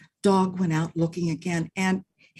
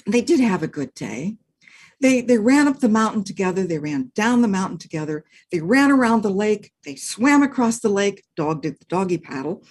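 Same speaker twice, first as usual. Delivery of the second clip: ordinary delivery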